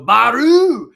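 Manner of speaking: put-on high, loud toddler shouting "Baru!" in a Japanese-sounding way; toddler Japanese accent. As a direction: yell "Baru!" the way the baby does with the Japanese accent. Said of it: "it's so Japanese".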